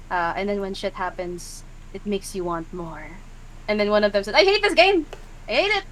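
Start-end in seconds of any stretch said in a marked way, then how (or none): 4.3-5.8 high, loud whiny complaint; upset player whining about the game, higher; frustrated whine. Acted. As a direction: whine that you hate the game. Player sulking.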